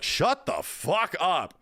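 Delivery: slow, drawn-out exasperated retort; mock outburst at a chatty device; retold exasperation. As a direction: stretch each word in fed-up annoyance. Retold snapping at talking device.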